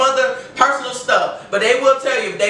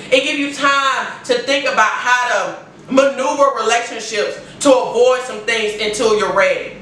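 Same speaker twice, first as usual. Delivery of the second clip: ordinary talk, nothing marked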